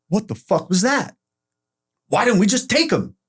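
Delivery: high-pitched, indignant outburst; worked-up protest to the crew; retold flipping out. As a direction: demand it in heated disbelief. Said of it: "I start flipping out".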